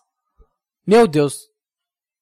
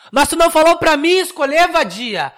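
mock angry outburst; 8 semitones higher, 5 dB louder than usual. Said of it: "acted quarrel over a film".

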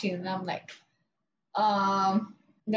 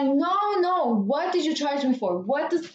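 higher, insistent mock customer; 4 semitones higher than usual. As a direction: imitate her, pressing the question. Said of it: "She's asked me, like, four times already".

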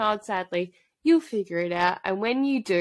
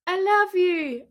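slow, higher swooning I love you; mock declaration; 10 semitones higher, 3 dB louder, slower than usual. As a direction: ham up a lovesick confession. Mocking Cale's declaration.

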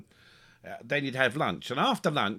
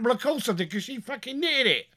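exasperated retort; 8 semitones higher than usual. Retold or imitated. Retelling his phone reply.

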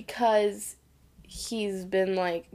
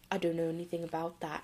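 fast, much softer mumble of a denial; mumbled denial; 4 semitones lower, 10 dB quieter, faster than usual. Retold or imitated. Tom dodging L-Man's question, quick hushed mumble. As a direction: rush the denial under your breath. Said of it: "And he just goes".

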